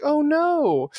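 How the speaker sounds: high, shocked wife. Retold or imitated. Wife reacting to her husband's news, high. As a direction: gasp it out in a high voice.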